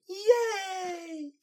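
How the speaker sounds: high squealing yay; excited cheer. Acted. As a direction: shriek it with joy. Pinkie cheering.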